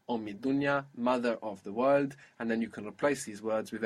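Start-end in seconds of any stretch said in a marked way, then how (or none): none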